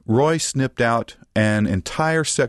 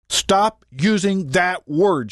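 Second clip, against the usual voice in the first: higher, slow, punched-out order; stern command; 6 semitones higher, slower than usual. A narrator's reading. Mama orders Roy to stop swearing, stern command. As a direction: order him firmly, word by word. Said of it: "she commanded".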